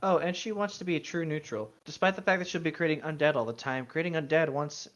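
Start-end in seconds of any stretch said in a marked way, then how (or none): none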